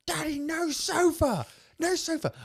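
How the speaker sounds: put-on very high, slow chanted toddler demand; sing-song it like a stubborn little girl; imitating his daughter's repeated protest. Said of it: "she just started going".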